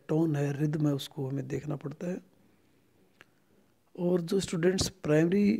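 ordinary talk, nothing marked

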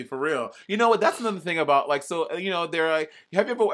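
ordinary talk

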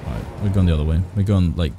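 ordinary talk, nothing marked

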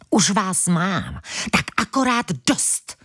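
slow, menacing hiss; slow seething hiss; hissing with anger. Acted. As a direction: hiss the threat through the teeth. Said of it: "syčel Platón na ventilka".

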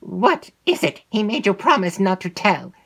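cold command to a servant; stern. Acted. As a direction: mistress sternly questioning her maid. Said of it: "said her lady sternly".

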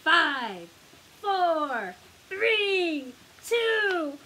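loud countdown. A narrator's reading. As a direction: pitch climbing with each number. Countdown to the splash.

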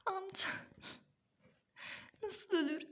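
high, choked voice of a grieving wife; wailing in disbelief; grief-stricken. Acted. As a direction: plead through the tears. Mother grieving husband's death.

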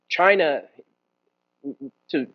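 ordinary delivery

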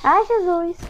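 sudden high yelp of fright; startled cry. Real, not acted. Gamer spooked by a creature, high startled cry. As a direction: jump out of your skin on Jesus.

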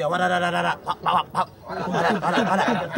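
rapid mock machine-gun "da da da" bursts; laughing gunshot sound effects; imitated gunfire. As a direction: fire off the gun noises playfully. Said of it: "it's the sound that they make".

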